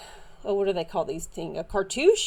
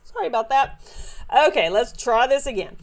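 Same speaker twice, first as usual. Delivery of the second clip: ordinary talk, nothing marked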